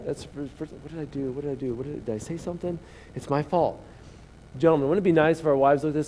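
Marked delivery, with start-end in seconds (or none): 0.1-3.8 softer, hurried, worried mumble; anxious husband muttering, softer; mock panic. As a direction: play the nervous husband. Husband panics after wife's words.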